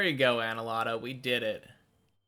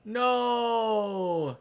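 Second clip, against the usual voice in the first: anguished no; 11 semitones higher, 5 dB louder than usual. Real, not acted. Reacting to failure.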